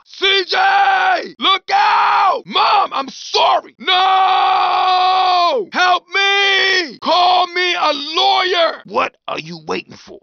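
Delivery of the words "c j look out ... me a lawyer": high, loud desperate cries, partly slow; panicked screaming. Acted. Victim screaming at the driver after being hit, desperate yell. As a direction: scream for your life. Run over, screaming for help.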